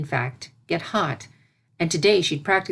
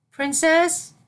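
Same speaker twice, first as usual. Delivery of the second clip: put-on high, small voice of a young filly calling; a timid young pupil peeking in; little filly voice; 11 semitones higher, 3 dB louder than usual. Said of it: "came a familiar little voice".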